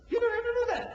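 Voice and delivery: very high-pitched mock dismissal; mocking reassurance. Preacher mimicking an easy-salvation pitch to his audience, mocking and high. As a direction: wave it away in a squeaky-high voice.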